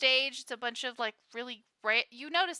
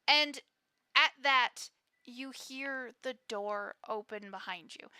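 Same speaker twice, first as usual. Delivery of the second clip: normal talking voice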